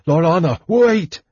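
high, loud shout climbing on the last word. A narrator's reading. Fighter calling to her above the chaos, shouting. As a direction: shout over a din to stop someone. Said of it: "he shouted above the chaos".

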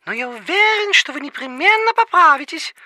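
ordinary delivery

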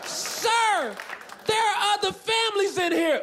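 put-on very high, pleading Southern-accented reprimand; scolding Southern nurse. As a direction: protest high in a mock Southern country voice. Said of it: "thick Southern country accent".

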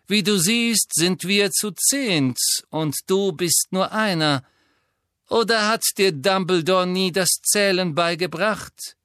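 mock him like a cruel teacher; sneering. A narrator's reading. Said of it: "höhnte Lucius Malfoy".

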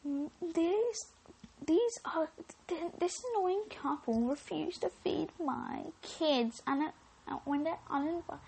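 mock-indignant neighbour complaining to others; gossiping neighbour mum. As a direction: play the offended mum in a high voice. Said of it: "then she will tell others".

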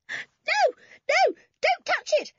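very high, loud squeal; frightened cry. A narrator's reading. Anne begging Toby to leave the spider alone, panicked cry. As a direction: shriek in terror to stop him. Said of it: "cried Anne".

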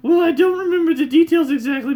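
squeaky high village woman; loud high-pitched old lady character; high NPC lady voice. Acted. Voicing village woman NPC dialogue.

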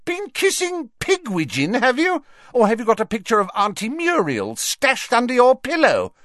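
high-pitched mocking taunt; derisive screaming. Ginny jeering at her brother, derisively. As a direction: jeer at him, voice shrieking high. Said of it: "screamed with derisive laughter".

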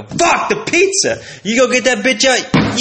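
high, slow fed-up yell; mock-exasperated cry to nobody about dinner; frustrated outburst. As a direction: give up on the pizza with a yell.

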